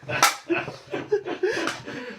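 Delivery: giddy laugh. Retold or imitated. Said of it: "Jackson's just kind of like".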